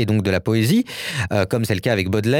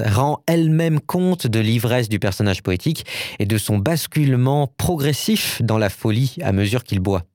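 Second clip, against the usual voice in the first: ordinary delivery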